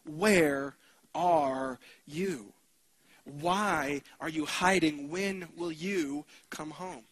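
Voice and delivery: very slow, drawn-out gentle questions; slow tender plea. Acted. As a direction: linger on each word as if calling someone home. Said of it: "he's still asking that question".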